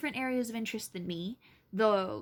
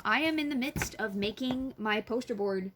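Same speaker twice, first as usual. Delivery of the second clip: ordinary delivery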